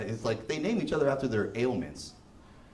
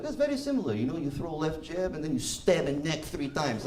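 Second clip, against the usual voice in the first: put-on a boxer explains stabbing in a casual Russian accent. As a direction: play the Russian boxer, matter-of-fact in a Russian accent. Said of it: "He's like".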